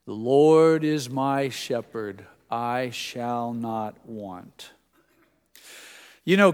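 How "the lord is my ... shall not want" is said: very slow, measured psalm recitation; congregational recitation. Real, not acted. Pastor leading the congregation in the first verse, slow recitation. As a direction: lead a group in reciting, drawing out each word. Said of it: "let's all say the first verse".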